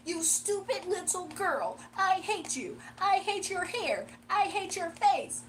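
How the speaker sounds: slow, high, emphatic mock insults; mock hateful rant. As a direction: play an over-the-top hater, drawing out every jab. Acting out the hater's insults.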